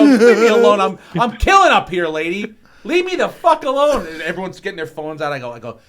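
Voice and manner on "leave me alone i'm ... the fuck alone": loud, high retold outburst at a heckler; exasperated yelling. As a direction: shout her down, re-enacting an annoyed comic. Retold clash with audience lady.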